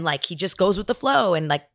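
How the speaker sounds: usual speaking voice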